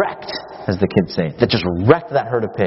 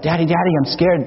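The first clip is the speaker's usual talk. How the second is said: mild, higher-pitched imitation of a frightened child; mock scared boy; 7 semitones higher than usual. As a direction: lightly act out a scared little boy calling for Dad. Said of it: "the boy screams out".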